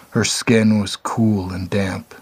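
plain reading voice

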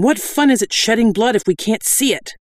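high-pitched gleeful question; enthusiastic. A narrator's reading. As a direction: argue eagerly for a fight. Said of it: "Gerda chimed in with enthusiasm".

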